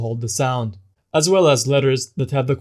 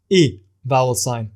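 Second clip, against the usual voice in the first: normal talking voice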